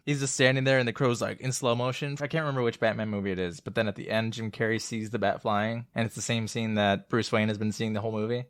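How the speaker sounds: ordinary delivery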